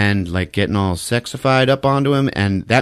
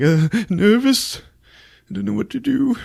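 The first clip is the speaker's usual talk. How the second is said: mock nervous Kevin; 11 semitones higher than usual. Kevin in the tub, much higher timid voice. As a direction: squeak it out like a shy boy. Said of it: "He's like".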